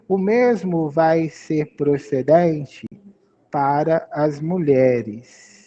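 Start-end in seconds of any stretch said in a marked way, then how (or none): none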